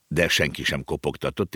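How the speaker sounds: ordinary delivery, nothing marked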